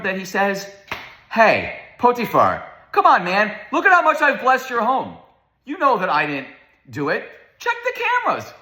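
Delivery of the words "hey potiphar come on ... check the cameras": mock indignant protest. Imagined Joseph protesting to Potiphar.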